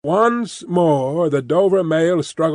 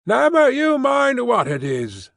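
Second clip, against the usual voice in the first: high, slow called-out rebuff; drawn-out high retort across a distance; shouted retort; 10 semitones higher than usual. A narrator's reading. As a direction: holler back high, giving nothing away. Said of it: "the guard retorted".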